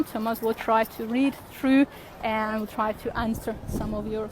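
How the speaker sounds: normal talking voice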